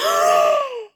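loud, high drawn-in gasp; horrified gasp. Acted. Realising friend has eaten Oreos, horrified gasp. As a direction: gasp sharply at a dreadful realisation.

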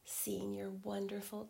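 ordinary talk

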